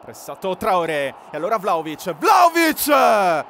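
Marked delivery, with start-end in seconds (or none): 2.1-3.4 stretched, high and loud name call; excited shout. Real, not acted. Striker bursts through on goal.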